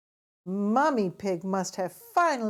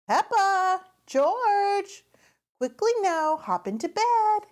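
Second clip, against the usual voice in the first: mummy calling; 12 semitones higher, 5 dB louder than usual. A narrator's reading. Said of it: "says Mummy Pig".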